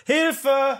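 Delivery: high fake call for help; mock cry for help. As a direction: cry out in play panic.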